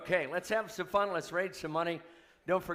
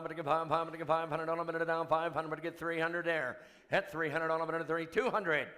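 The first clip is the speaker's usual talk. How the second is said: rapid auctioneer chant. Real, not acted. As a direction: rattle off the bids like an auctioneer.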